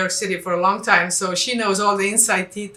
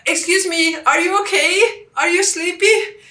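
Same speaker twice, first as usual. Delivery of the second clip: alarmed question; 9 semitones higher, 5 dB louder than usual. Retold or imitated. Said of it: "and I was like".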